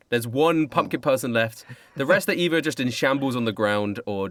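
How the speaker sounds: ordinary talk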